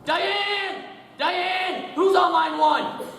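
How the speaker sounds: calls Diane high and loud; yelling for secretary. Acted. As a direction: bellow across the office.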